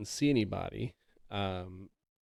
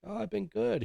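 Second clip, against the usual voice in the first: very high, lilting retold answer; cheery reply; 12 semitones higher than usual. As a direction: imitate an easy greeting, pitched way up. Voicing grandfather's cheery answer.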